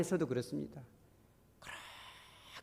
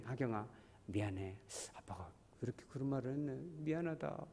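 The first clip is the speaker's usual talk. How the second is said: soft, low fatherly apology; tender apology; 3 semitones lower, 10 dB quieter than usual. Acted. Father says sorry to his child at bedtime, tender and quiet.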